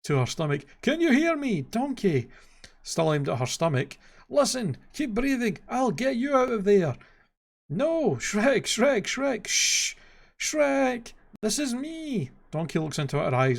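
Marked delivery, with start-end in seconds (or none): 0.8-2.3 very high, urgent call; Donkey yelling at her stomach; calling to someone trapped. A narrator's reading. 4.3-12.3 high, frantic exclamations; frantic cartoon alarm; panicked calling. A narrator's reading. Donkey panics, Fiona hushes him.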